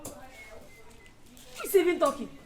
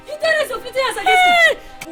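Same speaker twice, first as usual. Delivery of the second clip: outraged accusation; 11 semitones higher, 9 dB louder than usual. Acted.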